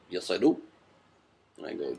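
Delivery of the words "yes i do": lightly voiced politician impression; Barack Obama. Candidate answering a reporter's green question, light Obama impression. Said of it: "It's Barack Obama voice".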